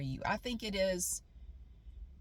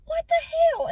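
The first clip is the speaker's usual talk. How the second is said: high, rising to loud disbelief; shocked cry that grows louder; panicked muttering; 8 dB louder than usual. Retold or imitated. Pacing around, panicking at falling revenue. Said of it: "just talking to myself".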